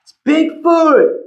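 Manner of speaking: storyteller announcing the creature; Bigfoot reveal. Acted. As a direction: unveil the monster with a flourish. Bigfoot reveals himself dramatically.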